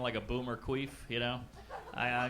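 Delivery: normal talking voice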